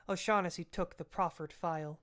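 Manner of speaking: usual speaking voice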